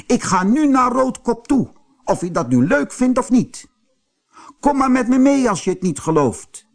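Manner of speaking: high-pitched indignant aunt; angry calling out. A narrator's reading. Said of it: "Ze rende de straat op en riep". (verontwaardigd roepende tante)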